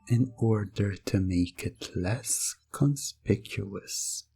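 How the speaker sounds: ordinary talk, nothing marked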